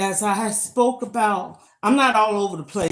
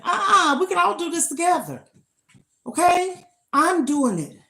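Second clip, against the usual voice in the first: sassy high uh-uh; 9 semitones higher than usual. Real, not acted. Urging viewers, rejecting shade.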